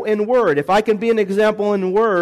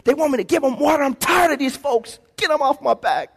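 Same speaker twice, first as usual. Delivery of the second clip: higher pitched exasperated griping; whiny complaint; 7 semitones higher than usual. Retold or imitated. Moses complains to God about the people, higher exasperated whine. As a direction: moan like a fed-up leader. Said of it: "I'm paraphrasing".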